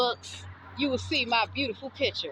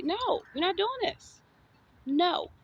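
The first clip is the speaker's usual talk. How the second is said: slow, higher, firm refusal; firm stand-up voice; 7 semitones higher, slower than usual. Retold or imitated. Standing up to a bully, a firm higher no. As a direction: plant your feet and shut the bully down. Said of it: "that's why I use that voice".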